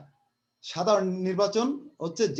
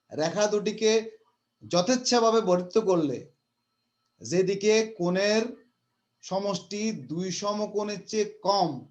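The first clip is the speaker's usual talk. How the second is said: slow, careful word-by-word reading; teacher slowly reading the hard fifth postulate; slow dictation; slower than usual. Shown as a demonstration. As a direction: take each phrase slowly for the class. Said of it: "আমি আস্তে আস্তে বলছি".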